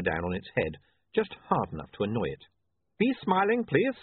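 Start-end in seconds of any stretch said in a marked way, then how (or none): 3.0-4.0 put-on light Uberwaldian accent, raised pitch; a hint of an Uberwaldian photographer; lightly voiced Uberwaldian accent. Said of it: "don't make fun of his accent".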